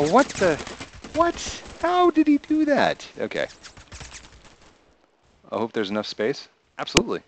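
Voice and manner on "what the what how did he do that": very high, loud, stunned "what"; shocked disbelief. Real, not acted. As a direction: squeal in total disbelief. Shocked by an in-game kill.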